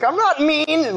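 put-on high, whiny kid protest; lightly voiced kid defending himself; little kid protesting. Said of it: "He's like".

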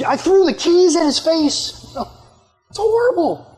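high, drawn-out mock wail; hammed-up anguish; acted horrified regret. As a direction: lament what you did, pitch way up. Worker horrified at his own behaviour.

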